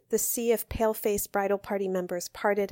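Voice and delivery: usual speaking voice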